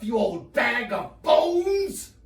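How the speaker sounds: high-pitched, drawn-out shouted insult; wife's bad-mood insult. Wife insulting husband in bad mood, angry shriek. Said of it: "when she's in a bad mood".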